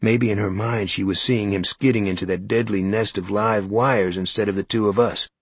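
ordinary delivery

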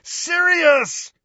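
very high, long bellowed call. A narrator's reading. Harry calling into the archway for Sirius, bellowing. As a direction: bellow the name to summon him back. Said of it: "he bellowed".

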